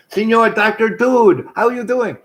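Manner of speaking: put-on high, loud call in a rough Jamaican accent; passer-by hailing someone, badly faked Jamaican accent; attempted Jamaican greeting. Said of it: "not in that accent, more Jamaican accent".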